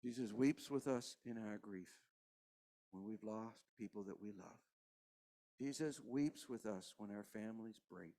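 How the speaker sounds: preacher quietly mourning with the congregation; hushed grieving preaching. Real, not acted. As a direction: let the grief sink the voice down. Quiet grieving sermon close.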